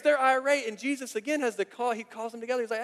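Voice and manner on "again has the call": ordinary talk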